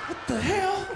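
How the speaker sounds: normal talking voice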